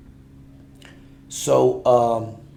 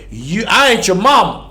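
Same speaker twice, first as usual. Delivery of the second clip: put-on raised, louder quote of a girl pushing back; quoting baby girl; 8 semitones higher, 13 dB louder than usual. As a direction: toss it back like the girl did. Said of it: "baby girl keeps saying".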